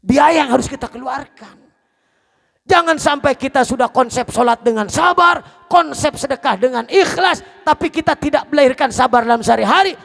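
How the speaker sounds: loud, high, driving sermon; preaching at full force. Acted. Preacher warning the congregation, loud and driving. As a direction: press the warning hard on the listeners.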